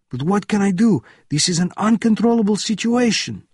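high, loud, agitated protest; officer's cry. A narrator's reading. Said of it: "the officer cried".

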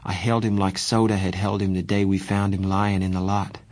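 usual speaking voice

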